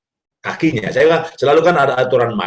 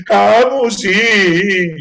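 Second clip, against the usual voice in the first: slow, loud, choked-up words; father crying while rubbing oil on his son's welt, slow and tearful; tearful gentle scolding; 6 semitones higher, 5 dB louder, much slower than usual. Retold or imitated. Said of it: "lalu bilang".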